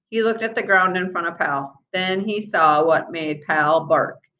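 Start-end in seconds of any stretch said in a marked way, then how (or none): none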